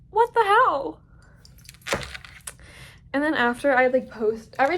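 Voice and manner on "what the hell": higher, louder disbelief; incredulous. Real, not acted. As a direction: cry out in bafflement.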